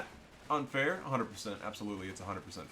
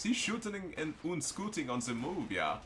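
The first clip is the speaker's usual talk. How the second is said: put-on raised, playful German accent; 6 semitones higher than usual. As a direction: slip into a mock German voice. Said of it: "I'm doing the German accent".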